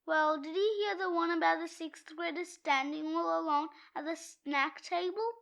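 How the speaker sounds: ordinary talk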